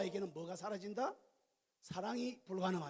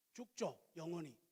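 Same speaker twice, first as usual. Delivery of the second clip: ordinary delivery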